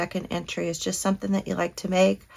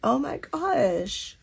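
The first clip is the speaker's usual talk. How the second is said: deeply touched; 4 semitones higher, much slower than usual. Real, not acted. Touched by a heartfelt gift.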